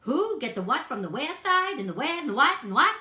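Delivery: high, loud, fast muddled question; bunny baffled by the order; frantic confused rabbit; flustered bunny. A narrator's reading.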